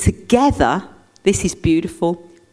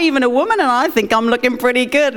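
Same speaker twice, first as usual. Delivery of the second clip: squeaky high, fast indignant protest; hammed-up high-pitched outraged man; 7 semitones higher, faster than usual. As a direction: play the offended man, much higher and quick. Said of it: "man's going like".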